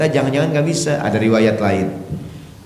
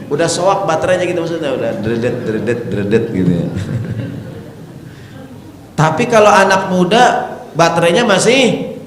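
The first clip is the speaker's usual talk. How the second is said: preaching at full force; fiery preaching; 6 semitones higher than usual. Acted. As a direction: rouse the congregation.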